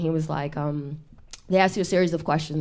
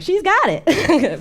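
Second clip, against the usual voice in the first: much higher, louder, slow drawn-out approval; mock proud mom; 12 semitones higher, 7 dB louder, much slower than usual. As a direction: stretch it out like a thrilled mother.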